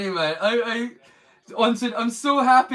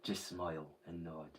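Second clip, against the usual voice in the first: much lower, much softer gravelly voice; quiet gravelly voice; 14 semitones lower, 18 dB quieter than usual. Shown as a demonstration. Showing viewers the voice that would mark him as trouble, low and gravelly. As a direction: drop into a low menacing rumble. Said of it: "Quiet, gravelly voice".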